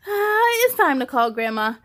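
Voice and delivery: a touch of despair; understated child's wail; mild wail. Acted. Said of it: "I wail".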